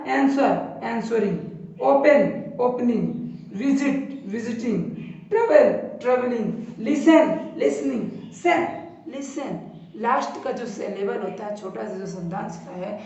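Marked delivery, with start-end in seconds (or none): 0.1-9.6 slow word demo. Shown as a demonstration. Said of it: "तो ये क्या है".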